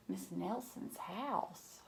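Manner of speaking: ordinary delivery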